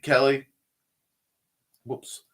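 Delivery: ordinary talk, nothing marked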